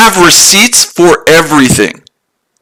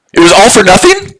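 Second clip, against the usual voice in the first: higher, disbelieving question; incredulous echo; 4 semitones higher than usual. Real, not acted. Trader echoing client's complaint, incredulous and higher. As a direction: throw his words back in disbelief.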